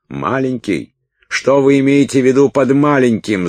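high, loud outburst of indignation; indignant yell. A narrator's reading. Уилт reacts to being called small, indignant yell. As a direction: yell it as if insulted. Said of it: "заорал Уилт в негодовании".

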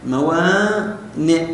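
موانئ drawn out slowly; slow dictation. Shown as a demonstration. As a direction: say موانئ slowly while writing it. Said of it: "بهذا الشكل". (موانئ ببطء كأنك تكتبها)

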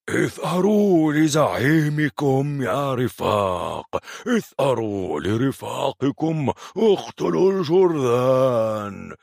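high, loud rallying battle cry; Narman's war cry. Acted. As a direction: rouse the giants to avenge their chief. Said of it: "صاح العملاق نارمان".

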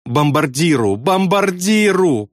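lightly sobbed plea, climbing in pitch; sobbed cry. A narrator's reading. Pilot begging help for bombardier, wailing sob. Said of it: "прорыдал Добс".

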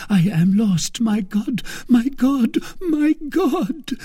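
high, pleading queen's voice rising; despairing queen. A narrator's reading. Queen alone, praying in rising despair. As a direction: climb in panic to the last cry.

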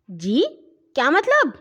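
high, loud surprised exclamation; astonished cry. A narrator's reading. Boys baffled by their sister's odd line, astonished. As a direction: cry out in disbelief. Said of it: "حیرت سے چلائے".

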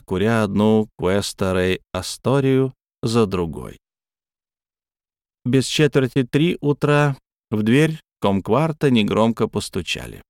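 ordinary delivery, nothing marked

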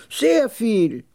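high, emphatic short answer; old camel trader. Acted.

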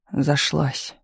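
ordinary delivery, nothing marked

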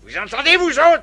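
high, loud call to the men; shouting. Acted. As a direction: shout it down the ranks. Adjudant passing the blame on.

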